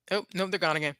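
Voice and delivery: ordinary talk, nothing marked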